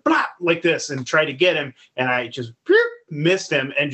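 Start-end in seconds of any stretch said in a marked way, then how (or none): 0.0-0.3 sudden loud gunshot noise with the mouth; imitated gunshot. Hog hunter acting out his pistol shot, imitated bang. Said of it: "so I fucking went". 2.6-2.8 quick silly shot sound; imitated misfire noise. Storyteller mimicking a botched shot at a pig, comic sound effect.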